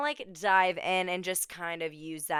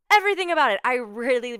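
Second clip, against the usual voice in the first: much higher and louder, bursting with delight; excited emphasis; 4 semitones higher, 7 dB louder, faster than usual. Real, not acted. Host gushing to listeners about the movie, excited. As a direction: shout it out with real glee.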